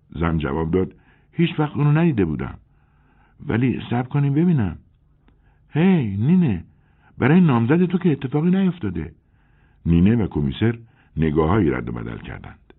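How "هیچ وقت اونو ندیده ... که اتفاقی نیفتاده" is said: much higher, louder worried woman; alarmed neighbour calling out to Nine; anxious woman. A narrator's reading. Celine worried, calls Nine. (زن همسایه مضطرب، بلندتر و بالاتر)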